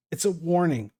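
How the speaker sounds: ordinary talk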